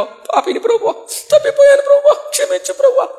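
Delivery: a hammed-up tearful confession after being scolded; mock weeping. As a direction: wail like a repentant sinner crying to the Lord. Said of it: "అని మళ్ళీ ఏడుస్తారు".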